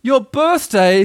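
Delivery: high, loud astonishment; astonished, high-pitched question; great surprise. A narrator's reading. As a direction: exclaim it, stretched out in amazement. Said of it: "said Pooh in great surprise".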